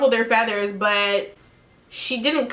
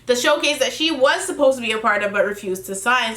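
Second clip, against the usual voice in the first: usual speaking voice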